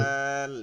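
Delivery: দাল spoken slowly with a long vowel; drawn-out letter name. Shown as a demonstration. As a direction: pull out the a in দাল. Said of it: "একটু টান দিয়ে বলি". (দাল একটু টান দিয়ে)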